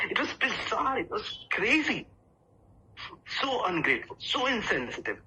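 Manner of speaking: high-pitched, acted indignation; indignant outrage. Speaker outraged at the clone asking him to pick up the phone, indignant. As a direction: protest in high-voiced disbelief at the audacity.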